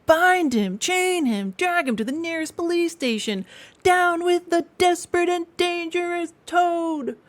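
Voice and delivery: rally everyone to grab him; outraged shouting. A narrator's reading. Passengers crying out against the wicked Toad, loudly.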